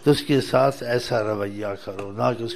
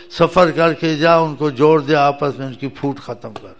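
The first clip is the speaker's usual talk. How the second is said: louder, rising sermon exhortation; fervent preaching; 7 dB louder than usual. Acted. Preacher urges listeners to reconcile, fervent preaching. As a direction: press the call to make peace with force.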